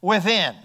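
"within" set apart as the key word; emphasis on within. Shown as a demonstration. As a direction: land deliberately on "within". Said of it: "I want to emphasize that word".

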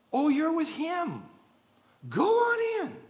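a bright, high, ringing welcome from the angels; high cheerful welcome. Acted. Angels greet the believer at the gate, high and beaming.

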